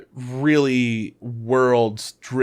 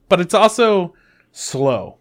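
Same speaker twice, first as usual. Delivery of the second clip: "slow" stretched out after a pause; "slow" said long and deliberate; drawn-out emphasis; 5 semitones higher, 4 dB louder, slower than usual. Real, not acted. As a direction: hold back, then land on "slow". Reviewer stresses the drawback.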